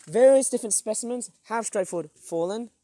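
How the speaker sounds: ordinary delivery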